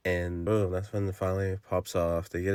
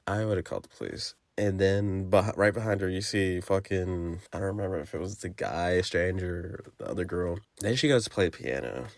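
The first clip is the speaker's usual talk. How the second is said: ordinary talk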